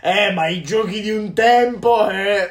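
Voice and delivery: put-on slow, loud, whiny old-timer; mocking nostalgic gamer. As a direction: drag it out like a grumbling nostalgic. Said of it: "quelli scemi che dicono". (voce lamentosa e strascicata da nostalgico)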